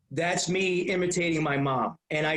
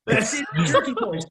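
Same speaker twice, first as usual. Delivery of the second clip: excited shout; 8 semitones higher, 4 dB louder than usual. Retold or imitated. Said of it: "they're like".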